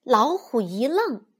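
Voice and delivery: usual speaking voice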